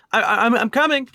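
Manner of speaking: high, loud, stammering shout; flustered high-pitched character voice; Link calling out. Acted. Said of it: "Link looks up and says".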